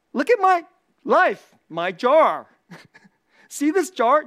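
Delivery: loud mock boast, starting high; playful, punchy bragging; mock showing off. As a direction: show it off proudly to everyone, hammed up. Acts out showing off one's life.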